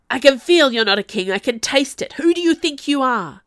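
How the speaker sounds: loud, high, raging accusation; hoarse furious screaming. A narrator's reading. Mad cousin accusing the Duchessa, screaming in rage. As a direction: keep screaming as rage takes over. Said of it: "he screamed at her, his voice breaking hoarsely".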